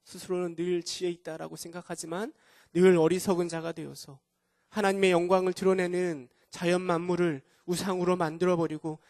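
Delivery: usual speaking voice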